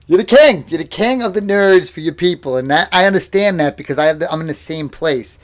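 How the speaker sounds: ordinary delivery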